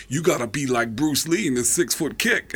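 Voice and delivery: put-on mentor's advice; Tracy Morgan impression. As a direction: pronounce it like odd comic wisdom. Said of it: "He goes".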